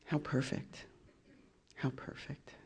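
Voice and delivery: breathy whispered irony; soft ironic whisper. Real, not acted. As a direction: whisper it to yourself, wryly. Quiet ironic aside, repeated.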